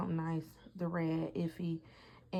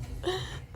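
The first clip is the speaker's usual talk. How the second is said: giddy high laugh; 14 semitones higher than usual. Real, not acted. Laugh at the gift.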